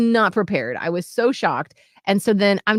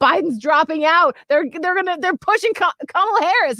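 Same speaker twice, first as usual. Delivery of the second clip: high, stumbling, louder blurt; urgent news-breaking; 8 semitones higher, 3 dB louder than usual. Retold or imitated. Retelling breaking the news to fiance, urgent blurt. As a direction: blurt out huge news in disbelief.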